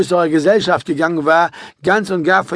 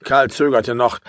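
ordinary delivery

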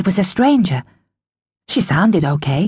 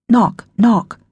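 ordinary delivery, nothing marked

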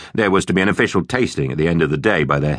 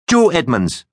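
raised, high telling-off; teacher's shout; 9 semitones higher, slower than usual. A narrator's reading. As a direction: call a pupil sharply across the classroom. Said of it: "shouted Miss Bruce".

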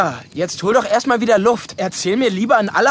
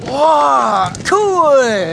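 amazed; 4 semitones higher, 3 dB louder than usual. Acted. Child amazed at fire.

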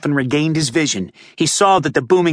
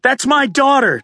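much higher, desperate shout; 11 semitones higher than usual. A narrator's reading. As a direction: cry out to the cops holding him. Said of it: "Charlie shouted".

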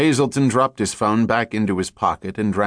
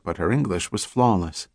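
plain reading voice

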